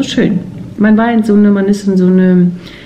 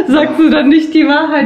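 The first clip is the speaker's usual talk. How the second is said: lachend; 9 semitones higher than usual. Real, not acted. Lachend den Partner necken.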